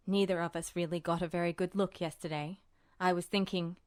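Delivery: a hint of a low, unbothered man talking business, fast; lightly voiced calm Roy. A narrator's reading.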